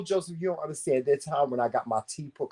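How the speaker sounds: ordinary delivery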